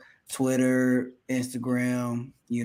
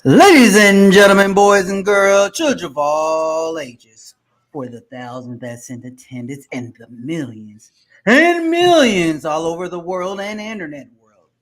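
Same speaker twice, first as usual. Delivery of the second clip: grand entrance announcement; 4 semitones higher, 10 dB louder than usual. Acted. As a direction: hype the crowd like a boxing MC. Opening announcer hypes the show.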